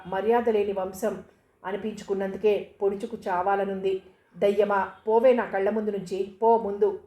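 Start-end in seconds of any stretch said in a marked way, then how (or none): none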